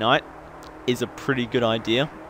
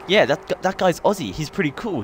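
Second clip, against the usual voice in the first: lightly mimicked, higher-pitched approving chatter; imitating British people; 6 semitones higher than usual. Brits talking about the Aussie guy, lightly imitated. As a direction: voice an impressed onlooker, slightly put on. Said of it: "for all the British people".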